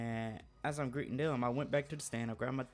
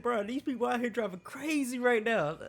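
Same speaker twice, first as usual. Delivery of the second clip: high-pitched imitation of a scared driver; mock panicked complaint; 10 semitones higher, 4 dB louder than usual. Friend driving on the highway complains, high mock panic. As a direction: mimic a rattled friend wailing down the phone.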